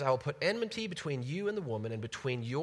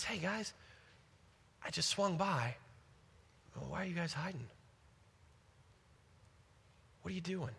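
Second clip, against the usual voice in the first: soft, easy, gentle questioning; softer, like a friend dropping by; gentle God; 4 semitones higher, 5 dB quieter than usual. Retold or imitated. God gently asking the hiding couple, soft and kind. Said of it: "he's so gentle in his approach".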